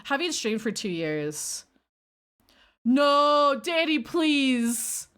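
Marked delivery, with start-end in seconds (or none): 2.8-5.1 loud drawn-out plea, stretching please. Real, not acted. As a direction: beg loudly, dragging it out. Begging the chatter to stop.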